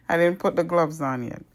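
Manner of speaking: loud, quick, laughing admission. Real, not acted. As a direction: laugh at your own slip. Laughs at own slip on camera.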